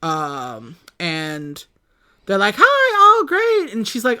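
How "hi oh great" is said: high, sarcastic "oh, great"; a sister's sour hello, pitched up; sarcastic greeting in a high voice; mock sarcastic greeting.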